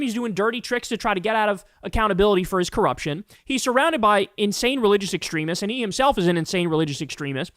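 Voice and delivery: ordinary talk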